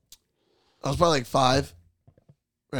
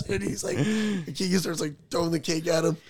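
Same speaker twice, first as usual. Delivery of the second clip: ordinary talk, nothing marked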